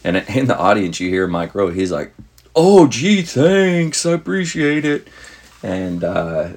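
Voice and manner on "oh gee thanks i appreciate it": much higher, louder mock-sarcastic thanks; playful fake-gracious thank-you in a put-on voice; joking thanks. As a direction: ham up a jokey gee-thanks. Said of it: "he said it jokingly".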